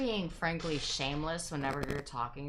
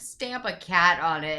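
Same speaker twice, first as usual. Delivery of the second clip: usual speaking voice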